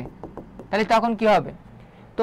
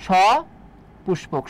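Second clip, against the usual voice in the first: slow syllable drill; much slower than usual. Shown as a demonstration. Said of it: "সপুষ্পক উদ্ভিদ".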